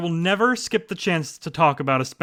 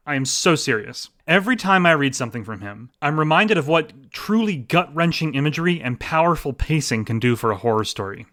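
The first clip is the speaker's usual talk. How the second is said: ordinary talk, nothing marked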